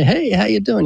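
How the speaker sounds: breezy hello in a mini skit; greeting a surgeon. Acted.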